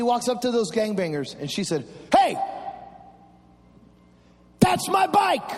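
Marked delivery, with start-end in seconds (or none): 2.1-5.5 sharp, high call of Hey, that's my bike; mock shout. Mom shouting at thieves who took the bike.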